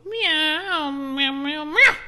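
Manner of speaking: high, loud hissing meows; angry cat meow. Acted.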